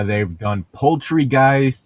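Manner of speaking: ordinary talk, nothing marked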